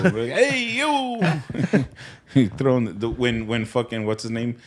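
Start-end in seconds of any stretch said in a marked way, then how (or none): 0.4-1.8 Italian mobster shout. Retold or imitated. Friend quoting a Sopranos scene, mobster yell. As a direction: bark it like an excited wiseguy. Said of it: "the Gabagoo".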